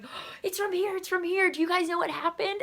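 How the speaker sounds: high, frantic mock panic; gasp, then cry out in alarm; imitated freaking out. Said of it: "They're freaking out".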